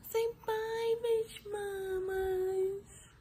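very high, long-drawn coo. Real, not acted. Mother coaxing baby to say goodbye.

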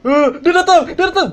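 terrified shriek; real fright, full shout; panicked scream. Real, not acted. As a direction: scream high and loud as the ghost comes. Ghost approaching in horror game.

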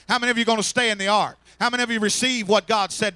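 loud, rousing preacher's call building to a peak; full-force preaching. Acted.

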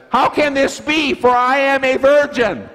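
high, loud stage bellow of a little girl playing Mary; a child belting her one big line in the Christmas pageant; bellowing child actor. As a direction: shout it to the back row of the sanctuary. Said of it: "bellowing out as loud as I've ever heard her yell before".

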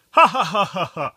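a burst of loud mock laughter. Real, not acted. To the friend after a dull story, hearty laugh. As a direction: laugh out loud at your own joke.

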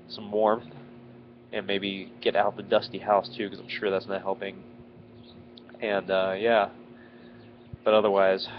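usual speaking voice

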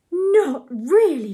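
storybook excitement, lightly hammed; excited cry. A narrator's reading. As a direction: gasp it out in delight. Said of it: "cried Ethel in excited tones".

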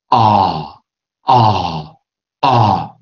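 啊 as a British back "ah", mouth set for O; sustained 啊 from the back of the mouth. Shown as a demonstration. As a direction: shape for O but say 啊. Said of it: "你想念O的那个音，但是你去念R".

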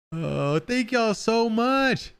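high, long drawn-out thank you; gushing thanks. Real, not acted. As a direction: gush it slowly, way up high. Gushing thanks after donations.

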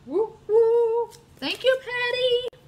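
high, loud whooping cheer; squealing thank-you; excited whoop. Real, not acted. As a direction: whoop with delight at a fan. Cheering viewer comment.

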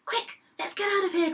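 high-pitched cry of alarm; mildly panicked storybook cry; urgent cry. A narrator's reading. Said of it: "cried the biggest billy goat".

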